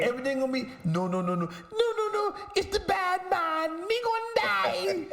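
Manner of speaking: high squeaky mock panic in a Jamaican lilt; mock panicked Jamaican voice. Imitating a friend who quotes the movie, high mock Jamaican panic. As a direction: squeal it like a terrified movie character in Jamaican patois. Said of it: "He'll be like".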